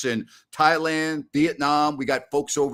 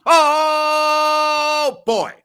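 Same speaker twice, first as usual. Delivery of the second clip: loud exclamation; 14 semitones higher, 9 dB louder than usual. Real, not acted. Exclaiming loudly after on-air promo.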